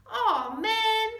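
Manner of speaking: high, very loud frustrated cry. Acted. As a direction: groan it out loud. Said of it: "cried Vinny".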